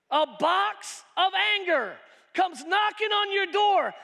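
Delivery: high, loud, shouting preacher; preaching at full force. Acted. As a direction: hammer the point home.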